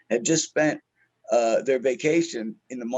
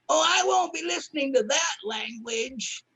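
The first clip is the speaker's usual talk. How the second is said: put-on high, slow, prissy old lady voice; prim old lady; 11 semitones higher than usual. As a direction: mimic the offended grandma in the front row. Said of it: "she goes".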